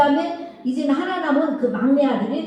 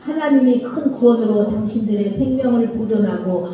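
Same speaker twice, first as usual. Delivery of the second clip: steady, louder Bible reading aloud; scripture reading; 2 semitones lower, 4 dB louder than usual. Acted. Teacher reading Genesis aloud to the children, steady scripture reading. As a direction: read the passage out for the class to follow. Class reads Genesis together.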